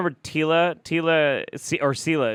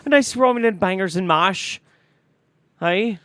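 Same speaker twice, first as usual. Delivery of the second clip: hammed-up Irish lilt, higher and louder; mock Irish accent; 10 semitones higher, 6 dB louder than usual. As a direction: tease him in a stage Irish brogue.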